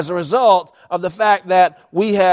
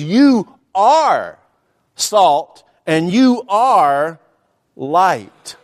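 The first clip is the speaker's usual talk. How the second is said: slow, pointed You are salt and you are light; emphatic declaration; much slower than usual. Shown as a demonstration. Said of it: "He says".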